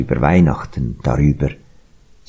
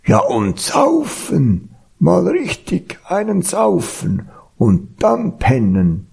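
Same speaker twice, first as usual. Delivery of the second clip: put-on ox voice, pitched up; boozy ox character. A narrator's reading. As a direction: stretch it out with oafish relish. Ox voiced, eager to booze.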